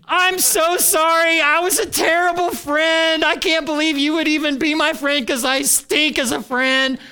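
high, pleading mock-sorry voice; hammed-up begging friend on one knee; groveling apology. Pastor mimics a friend begging forgiveness, high and pleading. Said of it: "imaginary conversations in your head where they are on one knee".

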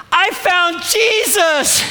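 slow, high, drawn-out cry of holy joy; ecstatic announcement. Retold or imitated. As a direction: proclaim it like a revelation. Acting out a convert's joyful announcement.

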